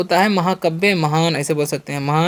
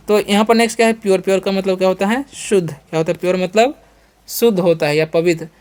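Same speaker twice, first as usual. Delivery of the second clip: ordinary delivery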